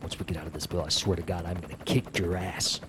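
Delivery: low, soft, seething threat; hushed threat. Acted. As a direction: keep it down while threatening him. Threat while told to hush.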